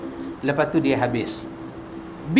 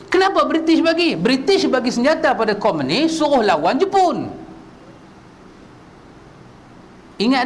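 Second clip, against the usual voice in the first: higher, pressing questions at the crowd; rising sermon delivery; 8 semitones higher than usual. Acted. Preacher questioning the congregation about British weapons, pressing and raised. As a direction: drive each question home to the listeners. Preacher builds his case on history.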